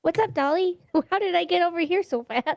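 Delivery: high-pitched, puzzled little voice; put-on high voice; perky character voice. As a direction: ask in a high, innocent voice. Player voices suddenly sobered character.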